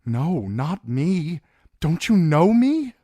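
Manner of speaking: high, loud, slow plea; pleading in fear. Acted. Dany pleading with her dragon: high, loud, frightened plea. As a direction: beg him to recognise you.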